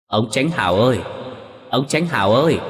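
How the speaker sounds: an eerie call from the dead, rising; ghostly distorted call. A narrator's reading. Drowned wife's ghost calling her husband, eerie and distorted. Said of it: "tiếng gọi đó méo mó không giống với giọng của một người bình thường".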